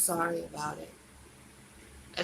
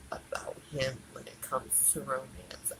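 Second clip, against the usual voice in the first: whisper; 8 semitones lower, 12 dB quieter than usual. Real, not acted. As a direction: drop to a whisper, much softer. Confession trailing to whisper.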